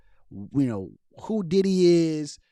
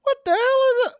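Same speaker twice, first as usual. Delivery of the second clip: shocked; 4 dB louder than usual. Real, not acted. Repeats even higher.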